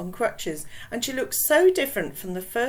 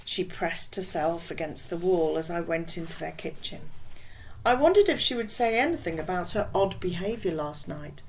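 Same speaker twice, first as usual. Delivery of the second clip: plain reading voice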